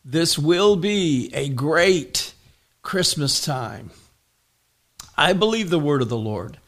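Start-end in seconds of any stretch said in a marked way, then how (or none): 0.0-3.9 slow, deliberate proclamation; prophetic decree. Real, not acted. Pastor decreeing a great Christmas over his congregation, slow prophetic decree. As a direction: declare it slowly, word by word. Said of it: "That is a prophetic decree".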